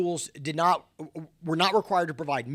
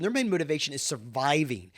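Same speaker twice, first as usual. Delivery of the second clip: usual speaking voice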